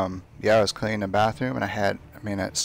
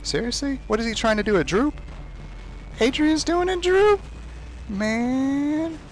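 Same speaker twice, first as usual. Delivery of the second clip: incredulous. Real, not acted. Gamer shocked at Adrian's droop, incredulous. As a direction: react in shocked disbelief. Surprised at opponent's move.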